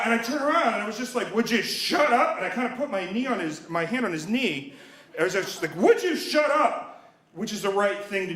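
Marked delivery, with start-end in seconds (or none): 1.3-2.4 higher, slow, exasperated retort; annoyed demand. Retold or imitated. Irritated moviegoer to the talker behind him, exasperated. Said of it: "screaming at you during a movie". 5.7-7.1 high, drawn-out angry outburst; screaming at him. Retold or imitated. Moviegoer grabbing the talker's knee, acted angry scream. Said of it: "screaming at you during a movie".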